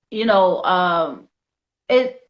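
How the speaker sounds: ordinary delivery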